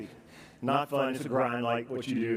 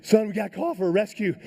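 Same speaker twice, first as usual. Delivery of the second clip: high-pitched urgent alarm; freaking out; 7 semitones higher, 4 dB louder than usual. Retold or imitated. Father to son on the wall, freaking out. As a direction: act out a panicked plea. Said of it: "I'm freaking out".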